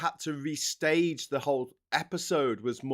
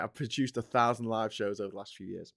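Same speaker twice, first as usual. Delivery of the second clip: ordinary talk, nothing marked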